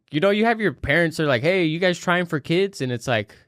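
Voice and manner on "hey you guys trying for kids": ordinary talk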